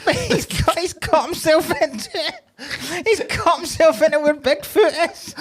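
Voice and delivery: high-pitched, helpless laughing delivery. Real, not acted. Telling co-host about the doctored family picture, laughing. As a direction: squeeze the words out through laughter. Cracking up at own joke.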